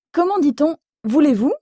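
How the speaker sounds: usual speaking voice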